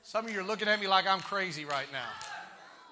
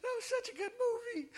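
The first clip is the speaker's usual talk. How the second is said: high, soft weepy moviegoer; hammed-up tearful little voice; mock crying; 12 semitones higher, 3 dB quieter, slower than usual. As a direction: imitate someone choked up after a film. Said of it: "they're crying".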